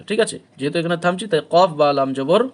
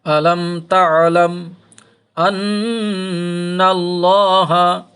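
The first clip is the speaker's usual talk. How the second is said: Quran recitation; 3 semitones higher, 4 dB louder, much slower than usual. Acted. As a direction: chant the verse in a drawn-out tajweed melody. Recites the verse. (ধীর সুরেলা তিলাওয়াত)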